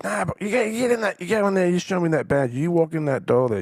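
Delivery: high, loud pushing voice; insistent urging. Retold or imitated. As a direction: urge him on, insistent. Stranger urges him in.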